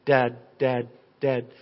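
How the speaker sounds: low, separate little syllables; imitated baby babble. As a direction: mimic a baby's repeated first sound. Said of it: "like a baby going".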